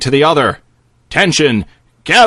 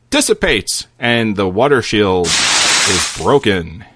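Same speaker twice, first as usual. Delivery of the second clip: ordinary delivery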